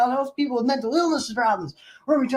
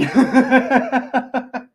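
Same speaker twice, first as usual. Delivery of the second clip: laughing; 5 dB louder than usual. Real, not acted. Laughs at a viewer on a computer.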